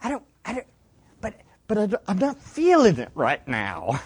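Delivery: mock hesitant, stammering excuse, starting softly; reluctant self-talk. Preacher voicing a believer who doesn't feel like it, mock reluctant. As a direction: play at faltering your way out of it. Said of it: "Have you ever said that to yourself?".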